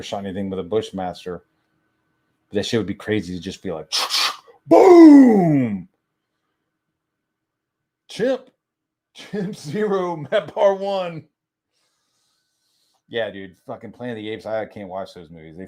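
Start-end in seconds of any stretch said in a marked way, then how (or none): 4.7-11.3 high, loud gleeful shout then a slow drawn-out score call; mock triumphant yell. Imagining shooting a chimp, high gleeful victory shout. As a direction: crow over the kill like a game announcer.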